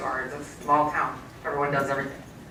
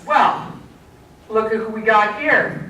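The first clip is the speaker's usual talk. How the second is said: higher and louder, a bright nurse's welcome; cheery nurse greeting; 5 semitones higher, 4 dB louder, slower than usual. Retold or imitated. Nurse greeting the girl in her rabbit-suited mom's arms, cheerful and higher. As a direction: greet them like a nurse happy to see a kid. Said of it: "one of the nurses says".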